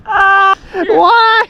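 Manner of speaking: startled cry. Real, not acted. Snow shoved into his mouth.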